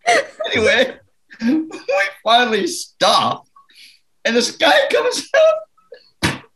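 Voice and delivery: laughing so hard the words nearly break. Real, not acted. Telling the story while cracking up laughing.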